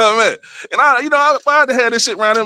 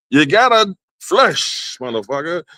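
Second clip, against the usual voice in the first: drawn-out punchline; slower than usual. Shown as a demonstration. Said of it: "It ain't no avoiding this shit".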